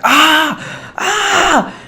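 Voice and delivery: high yelping scream as the flames hit; pained scream. Retold or imitated. Boy screaming after the grill burns his face. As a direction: cry out in sudden pain.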